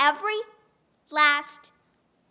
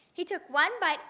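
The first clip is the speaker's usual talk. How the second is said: ordinary delivery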